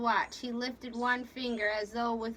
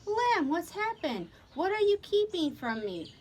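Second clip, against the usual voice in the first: raised pitch, pressing worry; anxious demand; 7 semitones higher than usual. A narrator's reading. Wife pressing visitor for news, anxious. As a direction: press him for the truth.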